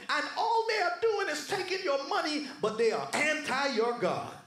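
rapid, driving sermon delivery; impassioned preacher building a charge; fired-up preaching. Real, not acted. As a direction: preach it hard, line piling on line. Preaches at full force.

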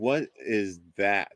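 drawn-out, very slow retold question; slow, spaced-out incredulous quote; retold disbelief. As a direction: stretch each word out in mock disbelief. Retells startled reaction to wail.

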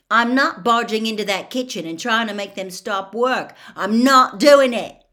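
loud, emphatic protest; indignant refusal. A narrator's reading. Ron refusing to join Hermione's campaign, loud and indignant.

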